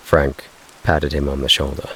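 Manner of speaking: ordinary delivery, nothing marked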